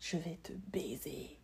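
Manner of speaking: unvoiced, very soft French whisper; retold whisper. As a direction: breathe the French line in a secret whisper. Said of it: "he said".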